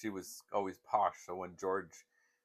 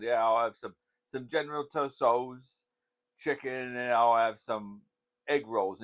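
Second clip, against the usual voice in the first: louder, slower ordering voice; mock order voice; 4 semitones higher, 8 dB louder than usual. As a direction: order food hamming it up. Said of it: "I think I said".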